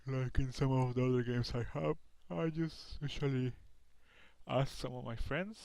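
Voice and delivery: talking through a yawn; words stretched by a yawn. Real, not acted. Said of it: "Sorry for the yawning".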